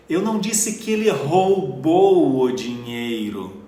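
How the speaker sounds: "roubou" leaned on, slow deliberate read; stress on ROUBOU. Shown as a demonstration. Teacher demonstrating stress on the verb "roubou". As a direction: press on "roubou" so the act is questioned. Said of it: "Então o que ele fez com o dinheiro?".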